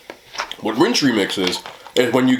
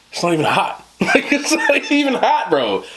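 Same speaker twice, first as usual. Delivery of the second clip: laughing disbelief; 13 semitones higher, slower than usual. Real, not acted. Disbelief at friend's spice tolerance.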